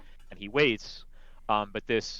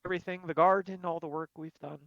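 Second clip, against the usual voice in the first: higher put-on voice, slowed, forced politeness; covering bad tea; 11 semitones higher, 8 dB louder than usual. Glamdry pretending River's stale tea tastes good. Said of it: "I lost my breath just trying to run over here".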